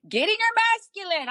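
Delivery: high-pitched hammed-up cheer; hyped mock coaching from the sidelines; egging someone on. Acts out cheering him on.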